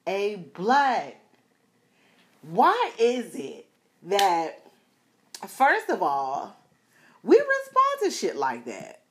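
loud street call; imitating a guy on the street. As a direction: call out to her across the street. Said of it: "he stops me and he says".

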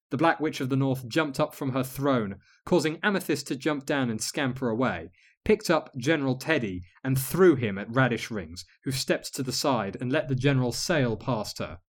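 ordinary delivery

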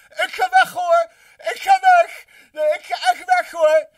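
very high, loud, whiny yell; mock party shouting. Streamer mimicking someone announcing their exit at a party, mock shouting. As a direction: shriek it like an attention seeker mid-room. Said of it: "dan gaat staan schreeuwen".

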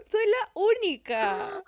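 high, slow, stretched boast; soy la única sung out high and slow; mock proud squeal. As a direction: drag it out high like a proud diva. Repeated joke boast.

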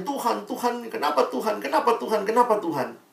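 a youth pastor lightly voicing an angry teenager; mock angry teen. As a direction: mimic a sulking girl blaming God. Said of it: "langsung dia marah-marah".

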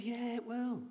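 high, light mock coaxing; coaxing reassurance. Uncle reassuring doubtful young nephew, high coaxing voice. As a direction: breezily brush off the doubt.

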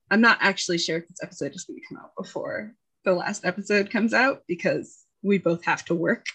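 usual speaking voice

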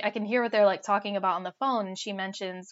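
ordinary delivery

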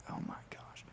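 whispered mock despair; hushed, deflated aside, all breath; dismayed whisper. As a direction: drop to a barely audible whisper of dismay. Dismayed aside at long video.

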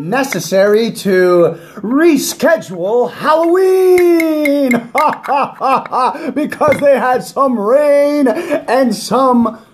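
high, drawn-out show host intro; announcer voice. Acted. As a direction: hype it up like a live broadcast. Podcast intro, announcer delivery.

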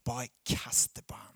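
a secret shared with the listener; whisper. Acted. Preacher whispers to congregation to cast worries on God, hushed whisper. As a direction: breathe it out, voiceless and close.

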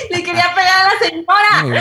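high, loud, laughing through the words; can barely get it out for laughing; laughing hard. Real, not acted. Retelling grandma's near fight, laughing loudly. Telling funny story, laughing.

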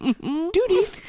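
high squeal of laughter; laughing. Real, not acted. Squealing the joke back at her friend, laughing.

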